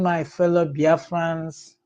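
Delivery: normal talking voice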